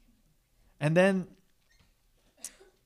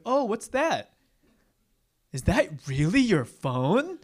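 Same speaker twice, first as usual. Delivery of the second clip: mock surprise; 6 semitones higher, 4 dB louder, slower than usual. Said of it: "they'd be like".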